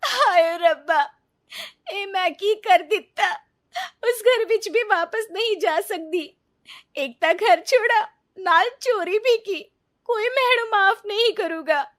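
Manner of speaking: high, loud, crying lament; weeping despair. Acted. Neeta crying alone that nobody will forgive her. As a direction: sob out the hopelessness.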